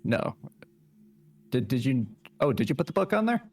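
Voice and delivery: ordinary delivery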